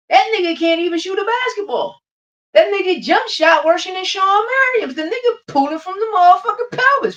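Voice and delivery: very high, loud, near-screaming disbelief; furious rant. Real, not acted. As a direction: lose it completely, mocking his jump shot. Peak of angry rant.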